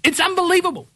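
high, slow, exasperated ranting; fed-up tirade about campaign slogans; worked-up rant. Real, not acted. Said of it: "fuck it, I'm not gonna be calm".

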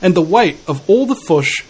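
"fish" said as "fush" in New Zealand accent; New Zealand accent, "fish" with a short "u" sound. Real, not acted. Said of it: "New Zealand accent coming out there".

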